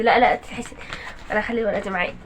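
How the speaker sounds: ordinary talk, nothing marked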